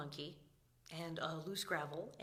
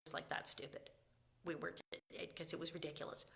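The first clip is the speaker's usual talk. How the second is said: ordinary delivery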